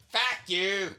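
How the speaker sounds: high, loud mock Scottish curse; Scottish accent. Trying out accents for the joke, a loud Scottish fuck you. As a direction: spit it out like a brawling Scot. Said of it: "Once again, Scottish".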